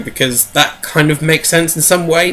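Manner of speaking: ordinary talk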